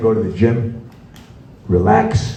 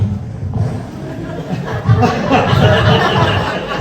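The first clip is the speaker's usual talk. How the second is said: breathy laugh; 13 semitones higher, 4 dB quieter than usual. Real, not acted. Laughs at himself.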